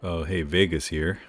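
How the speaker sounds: ordinary talk, nothing marked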